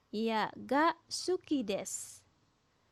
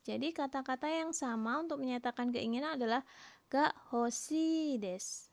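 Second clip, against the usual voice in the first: usual speaking voice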